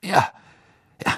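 high, fast, hurried; impatient. Acted. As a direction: brush it off hastily. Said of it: "sagte Fudge ungeduldig".

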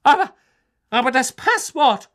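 high, loud alarmed cry; shrieking. A narrator's reading. McGonagall still shrieking.

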